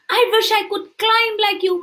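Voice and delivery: high, wishful line; longing lifted high; tortoise voice. Acted. Tortoise telling the squirrel his wish, high.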